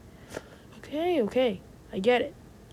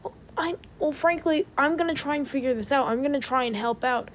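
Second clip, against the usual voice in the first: normal talking voice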